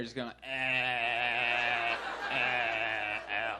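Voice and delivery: low drawn-out dazed groans; imitating a dazed moan. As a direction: groan like someone spaced out on drugs. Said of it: "just doing this right here".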